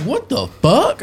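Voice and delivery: slow, high-pitched incredulous outburst; mock outraged disbelief; retold indignation. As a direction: act out being offended, stretched and high. Retells reaction to being called racist.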